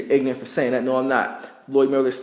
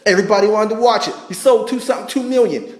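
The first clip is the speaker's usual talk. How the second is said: higher and louder, fired up; excited rant; 7 semitones higher, 5 dB louder than usual. Real, not acted. Ranting at the doubters about sales, excited and loud. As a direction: hammer home how big the sales were.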